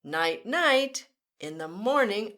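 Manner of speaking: usual speaking voice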